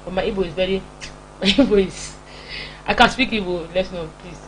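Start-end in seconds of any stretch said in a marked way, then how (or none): none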